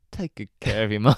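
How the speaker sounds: lightly voiced Joey hamming up a line; a hint of Joey's overacted delivery; Joey acting impression. Host imitating Joey doing his acting bit. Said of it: "Joey when he's doing the acting".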